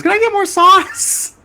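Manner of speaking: put-on very high, loud, drawn-out kid voice; hammed-up falsetto child; imitated little kid. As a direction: squeal it like a pleading little kid. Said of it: "Some Mexican kid".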